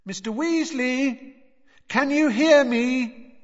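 slow, high, drawn-out call. A narrator's reading. Boy calling through boards to Mister Weasley, slow and high. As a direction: call it slowly through a wall. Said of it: "called through the boards".

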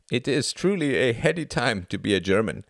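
put-on Klaus in a high German accent. A narrator's reading. Narrator voicing Klaus, the German boy, in a German accent. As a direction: pitch him up with a cheery German lilt.

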